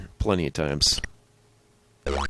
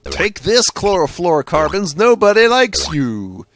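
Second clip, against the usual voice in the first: mock superhero; 8 semitones higher, 7 dB louder than usual. Mock superhero cellophane victory speech.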